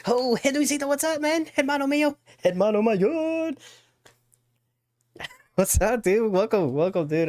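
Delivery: joyful high-pitched hello to a viewer; excited greeting. Real, not acted. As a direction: greet a chat friend with a big welcome.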